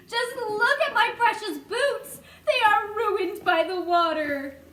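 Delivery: laughing wail. A narrator's reading. Centipede wailing over boots.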